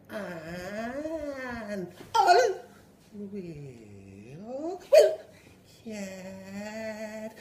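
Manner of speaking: high strained syllables; straining child. Retold or imitated. Boy repeating after teacher while bursting. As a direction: squeeze each word out under pressure.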